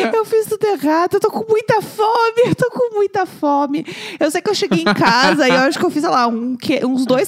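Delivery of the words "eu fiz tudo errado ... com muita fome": mock crying. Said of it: "abriu a torneira".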